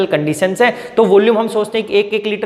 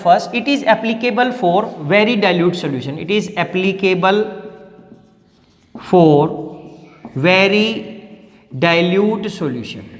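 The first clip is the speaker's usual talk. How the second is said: very slow, phrase by phrase; slow dictation; much slower than usual. Shown as a demonstration. Teacher dictating the first limitation to students, slow. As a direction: spell out each word for notes.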